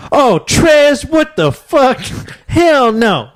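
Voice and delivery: high-pitched mock outcry; shocked girl. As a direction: imitate a girl's outraged shriek, very high. Girl's shocked outburst, retold.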